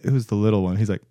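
normal talking voice

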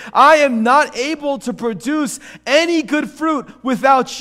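high, loud, impassioned confession; full-force preaching. Real, not acted. Preacher confessing he can't do it alone, full-force preaching. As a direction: preach your helplessness at full volume.

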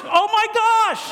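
high-pitched, hammed-up exclamation; acted alarm. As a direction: cry out in mock dismay. Acts out alarm on the ride.

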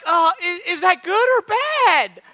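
high, squeaky-pitched comic question; baffled mock reply. Nephew answering his aunt, high-pitched mock confusion. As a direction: act out bewilderment, voice shooting way up.

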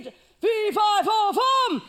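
booming giant chant, loud and high; hammed-up giant roar; giant's fee fi fo fum. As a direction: boom it out like a fairy-tale giant.

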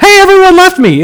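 high, quick, breezy announcement; mock cheerful. Shown as a demonstration. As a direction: chirp it as if it were happy news, hammed-up. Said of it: "that wasn't like".

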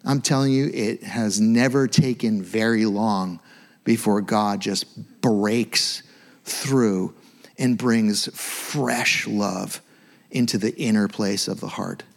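ordinary talk

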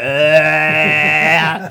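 loud, drawn-out "aaah"; mock tripped-out holler, held long; long dazed wail. Imitates collapsing into the trip.

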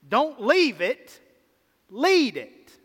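loud, slow emphatic call; forceful preaching. Real, not acted. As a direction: punch it like a preacher's climax. Climactic sermon punchline.

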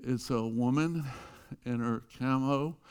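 usual speaking voice

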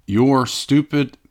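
ordinary talk, nothing marked

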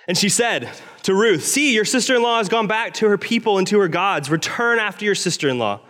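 measured, earnest Bible reading; scripture reading. Acted. Preacher reading Ruth aloud to the church, earnest. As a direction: read the passage to the congregation.

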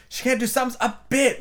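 "sums a BIT" high and emphatic; great emphasis. A narrator's reading. Both Queens judging Alice's sums, stressing "bit" emphatically. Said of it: "said together with great emphasis".

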